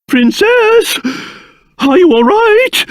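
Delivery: ordinary talk, nothing marked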